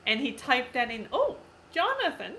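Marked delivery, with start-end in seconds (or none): none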